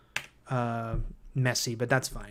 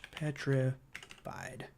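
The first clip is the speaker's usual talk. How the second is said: slow, quiet PETRIFIED with a gap inside; drawn-out word; 10 dB quieter, much slower than usual. Real, not acted. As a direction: spell it out slowly as if writing. Repeats word while writing it down.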